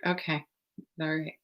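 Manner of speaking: soft, low retold acceptance; hushed, understated calm reply; unruffled, not hysterical. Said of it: "and I'm not".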